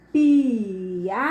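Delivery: "piada" stretched out as pi, a, da with pauses; syllable by syllable. Shown as a demonstration. Teacher showing the class each vowel, "piada" split into syllables. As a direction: draw "piada" apart syllable by syllable.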